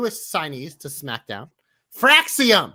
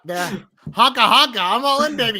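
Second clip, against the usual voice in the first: silly clown; 6 semitones higher, 8 dB louder than usual. Acted. Declaring himself a fan again.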